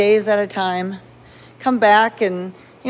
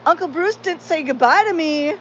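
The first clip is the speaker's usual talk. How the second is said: mock offended; 6 semitones higher than usual. Joking to family in the kitchen, mock indignant and high. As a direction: fake outrage as a joke. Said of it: "I got up ... and I go".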